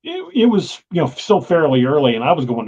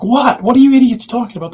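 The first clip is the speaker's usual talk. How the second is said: loud, high, fast disbelief; exasperated; 10 semitones higher, 7 dB louder than usual. Retold or imitated. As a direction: snap at your idiot buddies. Retold exasperated reply to friends.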